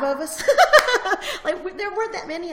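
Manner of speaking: laughing high and loud through it; real laugh at how few members there were. Real, not acted. Laughing as she tells the interviewer the club had five members.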